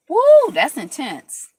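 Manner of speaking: loud, slow woo of amazement; excited. Real, not acted. Reacting to chemicals on the scalp, a loud slow woo. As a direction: let the woo burst out loud.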